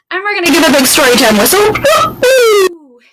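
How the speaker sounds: fast, loud, high burst into a woo-hoo; loud excited cheer. Acted. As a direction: pump the kids up.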